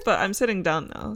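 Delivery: usual speaking voice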